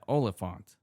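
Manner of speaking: OLIPHANT as OL-uh-font, three syllables; OLIPHANT, ending in a plain FONT; correcting pronunciation. Shown as a demonstration. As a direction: say OLIPHANT with no EE after the F. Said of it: "You're pronouncing it after the P".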